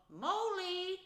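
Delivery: high, loud drawn-out call. A narrator's reading. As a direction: call out for a missing friend. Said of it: "Then he called".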